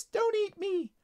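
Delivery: tiny, very high plea; little chiming voice. Acted. Ladybug begging not to be eaten, tiny voice. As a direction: plead in a very high, small voice. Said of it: "chimed a little voice".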